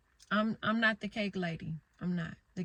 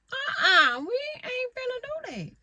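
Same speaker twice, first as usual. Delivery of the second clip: much higher and louder, refusing outright; loud mock refusal; 8 dB louder than usual. Scolding teenagers chasing likes, high loud refusal. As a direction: shout a flat no at the kids.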